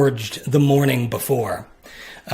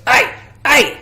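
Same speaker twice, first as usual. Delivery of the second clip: higher, louder call over the shoulder; shouted reply; 10 semitones higher, 4 dB louder than usual. Acted. Creature calling back to a shipmate, high and loud. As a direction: bark it back like a deckhand.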